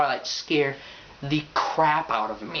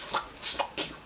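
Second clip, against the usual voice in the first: whispered curse; 10 dB quieter than usual. Retold or imitated. Girl muttering insult.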